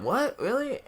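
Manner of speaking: high, rising questions; retold disbelief. Non-fans hearing the news, incredulous.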